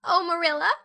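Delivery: high, loud sobbing cry. Acted. Anne sobbing to Marilla, high and tearful. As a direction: cry it into the pillow. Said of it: "sobbed Anne".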